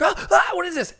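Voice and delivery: high, loud frightened yelp; startled panic. Acted. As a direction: recoil in sudden fright.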